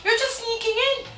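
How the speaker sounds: loud, rising mock outrage; exasperated scolding. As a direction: build from loud protest to high-pitched indignation. Acted scolding of her parents.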